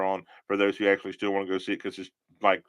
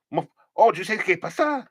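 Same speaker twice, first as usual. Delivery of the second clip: comic mishearing voice; 9 semitones higher than usual. Pretend. Mock mishearing in another voice.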